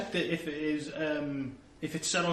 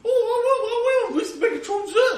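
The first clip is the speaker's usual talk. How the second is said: high, loud, squeaky Cockney urchin; small Cockney child; 10 dB louder than usual. Acted. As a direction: pipe up as an eager little Cockney kid. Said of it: "him to be a small Cockney child".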